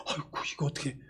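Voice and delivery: breathless, unvoiced gasp of dismay; dismayed whisper. A listener hearing bad news, whispering in dismay. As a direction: let the heart drop as you whisper.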